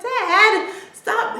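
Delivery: put-on high, loud teen whine at Dad; protesting daughter. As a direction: imitate an exasperated daughter. Daughter protests at dad's teasing.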